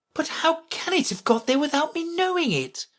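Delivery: very high, wondering voice; puzzled Alice. A narrator's reading. Alice to herself about the crown, high and baffled. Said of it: "she said to herself".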